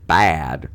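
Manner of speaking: bad stretched out loud with a broad A; exaggerated vowel. Shown as a demonstration. Comparing accents with a friend, exaggerated vowel.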